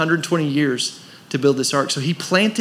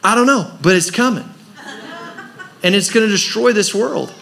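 Noah warning; 5 semitones higher than usual. Retold or imitated. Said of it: "he's going".

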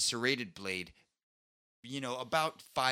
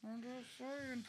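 slow, much higher and softer silly voice; silly put-on voice; 14 semitones higher, 12 dB quieter, much slower than usual. Banter about Australians, a slow high goofy voice. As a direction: say it like a gullible cartoon character.

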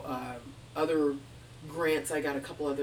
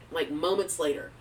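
normal talking voice

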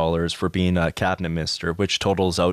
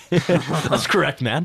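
high, drawn-out amused repeat; laughing echo; 8 semitones higher, slower than usual. Real, not acted. Co-host echoes the other host's "that's correct", laughing. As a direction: laugh through it, stretching the words higher.

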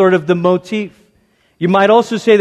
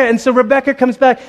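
usual speaking voice

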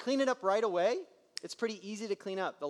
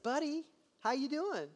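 gentle; 8 semitones higher than usual. Retold or imitated. Dad gently greets crying son.

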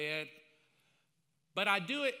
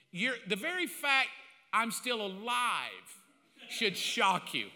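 ordinary delivery

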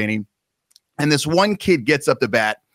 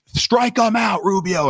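higher mock coach yell; coach losing it; 7 semitones higher, slower than usual. Coach losing his mind at the pitcher, mock yell. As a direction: shout it from the dugout. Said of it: "the coach is, like, losing his mind".

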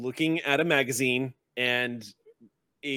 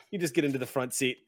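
ordinary talk

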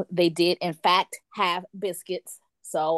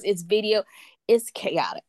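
ordinary delivery

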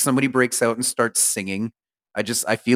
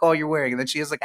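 ordinary talk, nothing marked

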